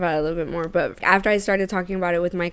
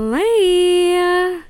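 high, loud, long-held slay; celebratory cheer; 11 semitones higher, 7 dB louder than usual. Real, not acted. Podcast host celebrates good news with co-host, drawn-out excited cheer.

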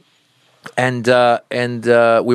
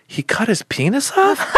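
put-on shocked kid; 13 semitones higher than usual. Son shocked by radio.

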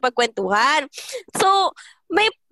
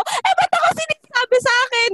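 put-on child's protest; 11 semitones higher than usual. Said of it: "sabi ko".

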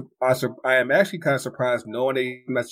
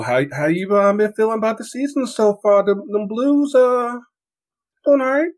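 high, bouncy host question; playful rising question; 11 semitones higher, 4 dB louder than usual. Real, not acted. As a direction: pitch up playfully to the guest. Host asks guest playfully.

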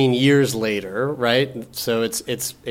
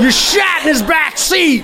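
joking shout; 12 semitones higher than usual. Real, not acted. Joking revenge suggestion.

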